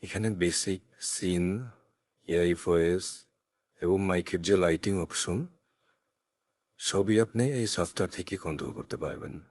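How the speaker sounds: ordinary talk, nothing marked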